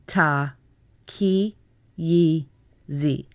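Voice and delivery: normal talking voice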